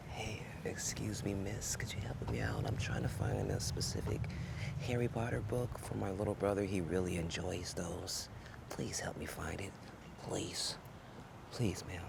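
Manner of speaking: very soft, breathy library hush; barely above a whisper, pleading. Acted. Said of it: "that's my library voice".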